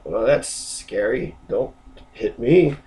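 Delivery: low, slow and soft; a small, wary plea, mildly scared; understated mock fear, taken slowly; intimidated reply. Acts the threatened person's reply.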